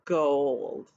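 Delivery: put-on a low, slow "Gold" for children to repeat; modelled word. Shown as a demonstration. Teacher leads children in repeating the word. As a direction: model "Gold" for the class to echo. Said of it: "Can you say that with me?".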